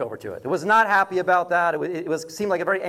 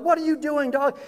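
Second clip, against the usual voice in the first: mock yell, pitched much higher; retold yelling at a dog; 8 semitones higher than usual. Truck woman yelling at her loose dog, mock high yell. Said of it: "is yelling at the dog".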